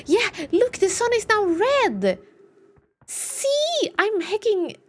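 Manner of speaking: high, loud burst of vindication; excited. Real, not acted. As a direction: exclaim it, thrilled to be right. Excited at being proven right.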